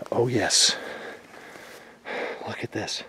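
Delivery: ordinary talk, nothing marked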